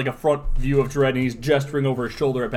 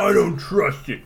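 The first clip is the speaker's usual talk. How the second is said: slow, lightly gruff lawman; a hint of a suspicious cop; gruff Dredd voice; 4 semitones higher than usual. Continues the Dredd imitation.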